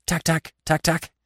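high, sharp pecking sounds; woodpecker drumming. A narrator's reading. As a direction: imitate a beak tapping on wood. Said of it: "He drummed on the oak trunk".

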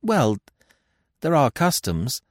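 high-pitched, hesitant reply; flustered, halting queen; stammering. A narrator's reading. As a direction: falter with pauses between phrases. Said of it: "she stammered".

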